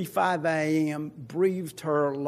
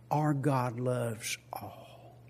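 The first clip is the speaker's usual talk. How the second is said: softer, slower, lower; tender and hushed; 6 semitones lower, 8 dB quieter, much slower than usual. Real, not acted. Pastor assuring the congregation God loves all, softly. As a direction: say it gently, slowly.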